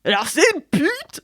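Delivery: hammed-up comic setup line; even worse Bigard imitation. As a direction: mimic a crude joke-teller, badly. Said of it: "Ton imitation est pire que tout".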